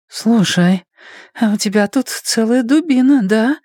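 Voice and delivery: high female voice, a touch of breathlessness; gasping girl. A narrator's reading. Ann asks Blaise while gulping air, mildly breathless. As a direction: play the girl catching her breath, pitched high. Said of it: "жадно хватая ртом воздух".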